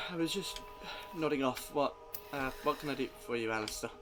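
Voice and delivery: freshly woken, a bit groggy; sleepy young woman. Acted. GM voices sleepy Anna.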